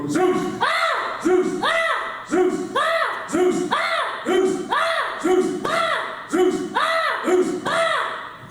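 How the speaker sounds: sharp, high shouted drill calls and kiais; drill shouts. Real, not acted. Instructor and student trading drill shouts. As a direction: bark each count and answer with a yell. Martial arts drill call and response.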